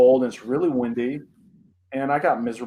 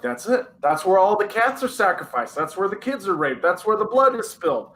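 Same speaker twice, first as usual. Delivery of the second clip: higher, mounting mock alarm; alarmed realization; 6 semitones higher than usual. Kid telling himself what the creepy fire pit is for, alarmed. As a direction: retell a kid's panicked conclusion, pitch climbing. Child's horrified reaction to firepit.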